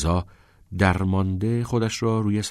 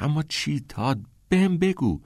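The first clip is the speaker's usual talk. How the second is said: pleading; 8 semitones higher than usual. A narrator's reading. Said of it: "نیل ملتمسانه گفت".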